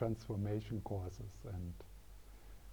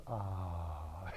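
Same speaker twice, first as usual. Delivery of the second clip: relieved sigh; 4 semitones lower than usual. Retold or imitated. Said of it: "the little fish is like".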